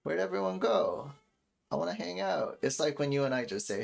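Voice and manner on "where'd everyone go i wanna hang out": higher-pitched, lightly voiced baby; playful pretend-baby voice; imagined baby's voice. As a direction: put a baby's wish into words, pitched up. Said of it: "for baby, crying could also just mean".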